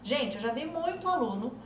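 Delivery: ordinary delivery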